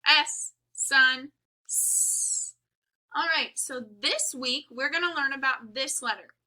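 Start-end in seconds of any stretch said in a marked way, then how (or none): 1.6-2.5 s hissed soft and long; drawn-out s like a snake. Shown as a demonstration. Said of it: "the sound that sounds like a s".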